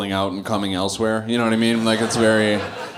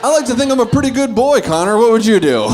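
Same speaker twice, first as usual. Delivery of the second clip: much higher, fast, laughing boast; laughing mock innocence; 12 semitones higher, 7 dB louder than usual. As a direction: gush it at the kid, half laughing. Absurd answer to kid, laughing.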